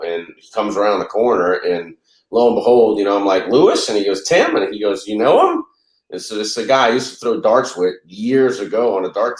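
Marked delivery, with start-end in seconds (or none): 3.5-3.9 high, loud surprised "Lewis?"; surprised recognition. Retold or imitated. Recognizing an old friend. 4.2-4.6 a much higher, delighted "Tim."; light up with surprise at the name; surprised greeting. Retold or imitated. Friend recognizes him back. 5.1-5.6 high, louder "You know him?"; surprised question. Retold or imitated. Show promoter's surprised question.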